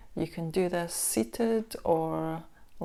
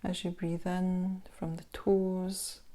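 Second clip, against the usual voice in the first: unhurried body-scan guidance with long pauses; guided meditation; 5 dB quieter than usual. Acted. Instructor guiding listeners through a body scan meditation, calm guided meditation voice. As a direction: ease the listener into stillness, leaving space between phrases.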